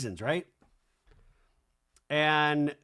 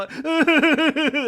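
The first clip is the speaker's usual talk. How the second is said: burst of laughter; 11 semitones higher, 9 dB louder than usual. Real, not acted. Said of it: "laughing mid-story".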